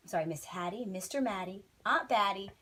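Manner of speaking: ordinary delivery, nothing marked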